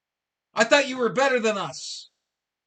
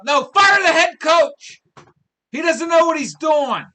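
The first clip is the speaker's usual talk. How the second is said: loud, higher, slowed outburst demanding the head coach go; angry shout; 6 semitones higher, 8 dB louder than usual. Real, not acted. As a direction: bark the demand with full frustration. Ranting at the program.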